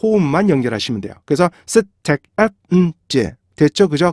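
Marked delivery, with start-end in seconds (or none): 1.7-3.4 셋, 택, 앳, 음, 제 as five separate beats; staccato syllable-by-syllable demo; syllables split apart. Shown as a demonstration. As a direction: break it into five evenly timed syllables. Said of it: "이렇게 먼저 분리를".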